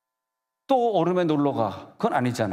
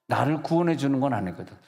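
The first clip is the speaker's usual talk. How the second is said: normal talking voice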